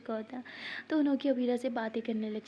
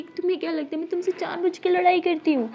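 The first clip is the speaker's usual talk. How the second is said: louder and higher, hurt and defensive; hurt, raised accusation; 6 semitones higher, 8 dB louder than usual. Acted. Wife defending herself to her husband, raised and hurt. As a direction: snap back at him, wounded. Acted couple quarrel.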